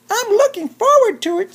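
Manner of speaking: very high, squeaky little chipmunk voice; tiny chipmunk voice. Acted. Chipmunk greeting Bear at the party, very high squeaky voice. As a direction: pitch way up like a small sweet chipmunk.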